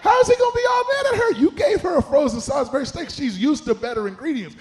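forceful, emphatic preacher's delivery; preaching. Real, not acted. Preacher applying the cooking-show story to church, preaching. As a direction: build the point like a preacher.

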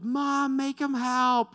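put-on high, loud tattling child voice; a kid whining to mom about a brother; whiny kid. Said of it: "they're like".

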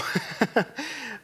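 high chuckle at own aside; amused laugh. Real, not acted.

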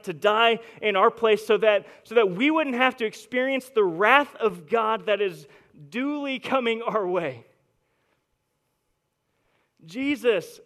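loud, driving preacher's voice; full-force preaching. Acted. Pastor preaching to his congregation, loud and driving.

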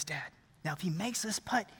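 low, soft murmur; hushed greenside voice; hushed son. Acted. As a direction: confide it quietly to dad. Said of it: "everyone's quiet".

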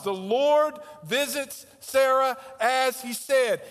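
each word of "The Lord visits Sarah as he said" laid down slowly; slow and deliberate. Shown as a demonstration. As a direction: spell out the promise being kept. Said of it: "spoken in a very matter-of-fact way".

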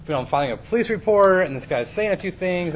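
ordinary delivery

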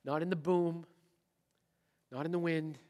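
normal talking voice

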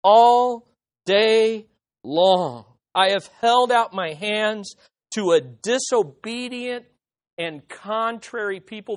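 drawn-out, weighty reading of the verse; slow scripture reading. Acted. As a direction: read it slowly, like holy words. Preacher reads scripture slowly.

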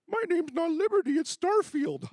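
high, loud squeaky pony greeting; toy pony voice. Pretend. Dad playing ponies with his daughter, high pony voice. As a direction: play a little plastic pony saying hello. Second pony corrects its name.

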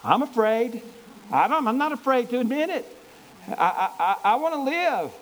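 high, loud mock-panicked plea; a hammed-up scared soldier; fleeing soldier admitting he's afraid, mock fear; frightened soldier. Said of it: "men are saying".

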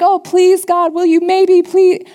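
high, pleading and hesitant; mock a meek, unsure plea to God; timid begging prayer. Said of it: "when I start praying and I'm like".

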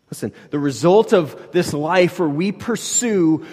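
preaching fervour lifting; building preaching. Real, not acted. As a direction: lean in and drive it home.

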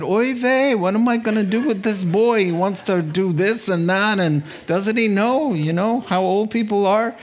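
put-on high, fretting Yiddish accent; kvetching in a Yiddish accent. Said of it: "went home to Jehoshaphat and said".

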